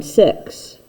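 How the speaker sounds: ordinary delivery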